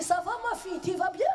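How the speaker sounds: suddenly sweet and high, all smiles; mock-tender father, the next day; sweet dad. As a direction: greet her like a doting father.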